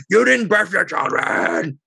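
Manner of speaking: slow, lightly imitated screech; a half-hearted mock shriek of an accuser. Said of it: "she screeched".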